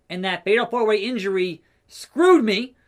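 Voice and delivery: ordinary talk, nothing marked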